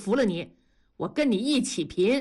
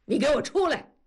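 higher-pitched summons into another room; short commanding yell; angry shout; 6 semitones higher, 3 dB louder, faster than usual. A narrator's reading. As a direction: order your son out. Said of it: "冲着大宝房间里喊".

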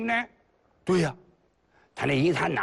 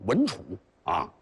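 usual speaking voice

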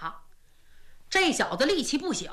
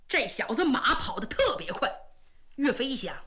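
ordinary delivery

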